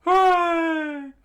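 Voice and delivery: high, very loud drawn-out cheer; screamed cheer. A narrator's reading. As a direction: cheer at full voice. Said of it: "Usopp screamed out".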